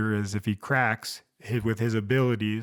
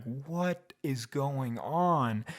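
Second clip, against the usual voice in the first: slow, hushed bewilderment; drawn-out, soft disbelief; bewildered; 3 semitones higher, 5 dB quieter, much slower than usual. Retold or imitated. Viewer baffled by Despero's twist, slow hushed bewilderment. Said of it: "And you're like".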